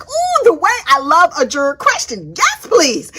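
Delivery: high, bright mock glee; playful excitement; eager delight. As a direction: gush over the juror question. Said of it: "I was like".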